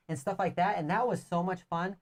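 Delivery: normal talking voice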